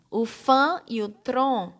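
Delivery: "fã" and "tron" slow, punched out alone; stressed syllables. Shown as a demonstration. Teacher picking out the strong syllables, stressed and slow. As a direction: lean slowly on "fã" and on "tron". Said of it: "são sílabas fortes".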